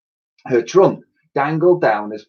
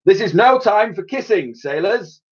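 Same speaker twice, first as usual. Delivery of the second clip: commanding; 9 semitones higher than usual. A narrator's reading. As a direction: bark it out like a proud old admiral. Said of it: "commanded the Admiral".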